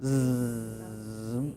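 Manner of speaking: long buzzing "Zzz" like a bee. Shown as a demonstration. A teacher showing the bee sound, buzzing Zzz. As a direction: buzz it like a bee. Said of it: "What's that sound like?".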